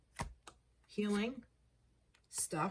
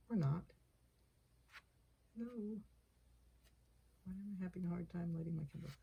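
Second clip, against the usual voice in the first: ordinary delivery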